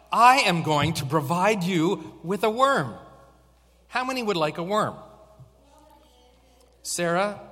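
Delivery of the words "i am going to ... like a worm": excited offer. Real, not acted.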